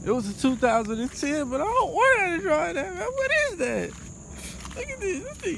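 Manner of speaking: complaining he won't drive that car; whiny complaint. Pretend. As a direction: whine it like a spoiled kid. Whining, rising pitch.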